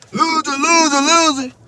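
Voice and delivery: high mocking chant; mocking taunt. Woman with hand in his face, mocking taunt. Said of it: "put her hand on my face like".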